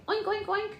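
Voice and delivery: very high squeaky oinks; mother showing her baby what a piggy says. Shown as a demonstration. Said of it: "What does the piggy say".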